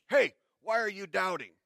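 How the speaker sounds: preacher imitating a harsh Jesus scolding Peter. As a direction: scold him like a stern teacher. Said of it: "Jesus was kind of being harsh there".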